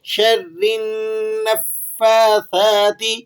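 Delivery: النَّفَّاثَاتِ with firm, held ن and ف, slowly; doubled letters. Shown as a demonstration. Teacher shows the tashdeed to students. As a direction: press the shaddas in النَّفَّاثَاتِ. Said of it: "سختی کے ساتھ ذرا سا پڑھیں گے".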